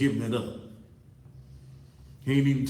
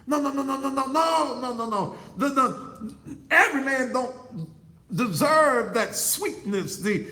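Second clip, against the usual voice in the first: full-force preaching; 9 semitones higher, 5 dB louder than usual. Acted. Preacher hollering at the women in the pews, full-force preaching. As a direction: preach it at the congregation like a revival climax.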